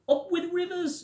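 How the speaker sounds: a cheerful dinosaur call; high hoot. A narrator's reading. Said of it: "And they hooted".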